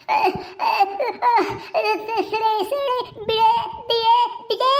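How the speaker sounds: single strangled words between gasps; choking plea. Acted. Woman being throttled begs attacker to let go, choking. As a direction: beg for release with no air left.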